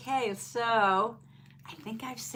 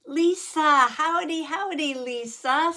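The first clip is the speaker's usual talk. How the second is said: louder, higher cheerful hello; excited greeting; 5 semitones higher, 8 dB louder than usual. Real, not acted. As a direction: call the name out happily. Greeting a viewer warmly.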